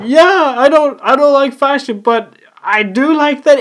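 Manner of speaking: squeaky loud mock fan; high fan voice. Imitating fans who dislike fashion, high and loud. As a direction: ham up an eager fan. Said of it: "And everyone's like".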